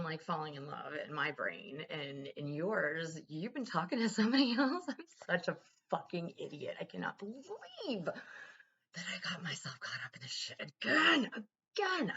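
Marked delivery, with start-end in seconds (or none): 4.9-10.3 whispered self-reproach; breathy whisper of self-disgust; self-scolding whisper. Retold or imitated. 10.3-12.1 high, louder, slow wailed again; rising exasperated cry. Retold or imitated. Self-berating outburst.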